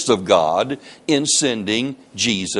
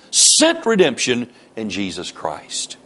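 ordinary talk, nothing marked

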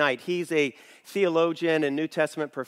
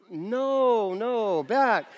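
high, drawn-out comic refusal; hammed-up guarding of the curtain; mock warding-off; 5 semitones higher, much slower than usual. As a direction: playfully fend off intruders in a higher voice. Fending off coach passengers in story.

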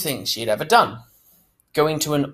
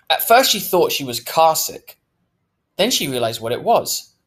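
ordinary delivery

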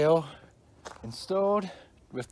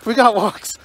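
usual speaking voice

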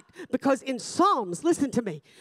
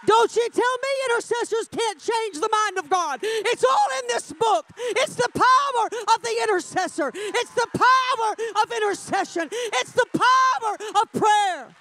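high, driving preacher's cry; full-force preaching; 10 semitones higher, 4 dB louder than usual. Real, not acted. Preacher defending the power of intercession, full-force preaching. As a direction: hammer each repeated phrase home.

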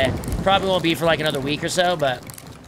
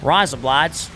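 Aussie razor blades said as ry-zuh bly-dz; broad Australian vowels on Razor blades; Aussie accent; much slower than usual. Shown as a demonstration. Streamer showing off his Aussie accent to chat. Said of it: "say razor blades in Aussie accent".

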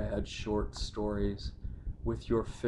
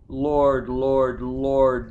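loud lament; 3 semitones higher, 9 dB louder, much slower than usual. Acted. Mourning son crying out to the Lord, loud falling wail. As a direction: call out to God in grief.

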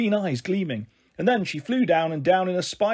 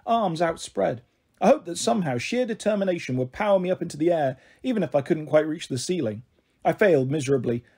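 usual speaking voice

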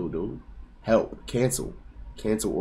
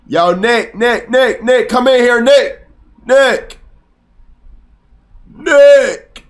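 much higher, louder yell for Nick down the hall; calling out loudly; 12 semitones higher, 10 dB louder, much slower than usual. Real, not acted. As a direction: call Nick from another room, rising each time. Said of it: "I can't yell too loud".

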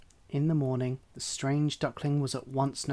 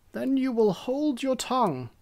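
much higher, louder scolding hen; haughty hen voice; 11 semitones higher, 4 dB louder than usual. Acted. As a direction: snap at the duckling from above.

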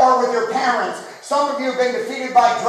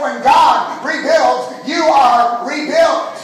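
slow, louder shout with "God" and "are" hit hard; emphatic shout; 3 semitones higher, 4 dB louder, slower than usual. Shown as a demonstration. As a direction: drive "God" and "are" home, slowly and loudly. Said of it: "Did you hear me?".

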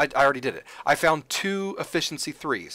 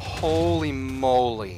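slow, stretched holy moly; awed; 5 dB louder, much slower than usual. Real, not acted. Gamer watching pick blast through rock, awestruck and slow. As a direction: let it out slowly in disbelief. Watching massive mining explosion.

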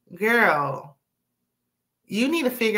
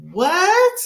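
loud, very high rising exclamation; incredulous; 12 semitones higher, 7 dB louder than usual. Real, not acted. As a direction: burst out in utter disbelief. Recapper reacts to the begging.

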